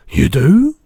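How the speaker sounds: loud, high, delighted question; suddenly brightening cry. A narrator's reading. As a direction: ask it in amazed hope. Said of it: "cried the B F G, suddenly brightening".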